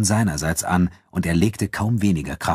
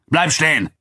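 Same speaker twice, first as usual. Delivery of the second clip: high, raised command; Abu Dun calling out; 10 semitones higher, 4 dB louder than usual. A narrator's reading. Nubian fighter orders his friend to stop, calling out. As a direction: call after someone walking away. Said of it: "rief Abu Dun".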